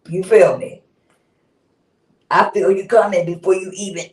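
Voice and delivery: ordinary delivery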